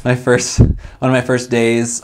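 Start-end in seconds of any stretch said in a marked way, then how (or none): none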